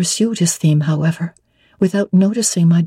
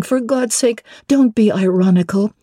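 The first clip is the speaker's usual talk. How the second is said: ordinary delivery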